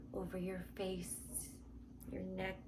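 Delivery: ordinary talk, nothing marked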